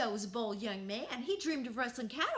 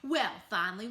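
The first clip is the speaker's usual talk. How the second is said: ordinary delivery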